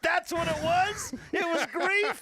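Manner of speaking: loud, high, slow mock-indignant protest; co-host playfully scolding his bandmate, loud and high; mock outrage. Real, not acted.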